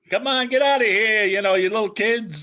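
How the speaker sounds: put-on high, loud, gruff bully cop shooing kids; bully Irish cop. Host imitates an old movie bully cop shouting at kids. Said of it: "the bully cop and the Irish cop".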